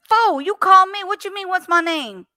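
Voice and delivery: high, loud exasperated retort; indignant. Retold or imitated. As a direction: scoff at the caller's question. Indignant at scam caller.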